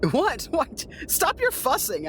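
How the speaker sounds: normal talking voice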